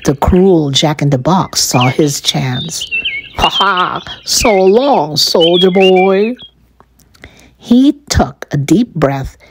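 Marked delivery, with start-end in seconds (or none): 3.4-6.3 mock villain's taunting farewell; gloat, drawing out the last words. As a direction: cruel jack-in-the-box jeer. Cruel Jack-in-the-Box taunts soldier.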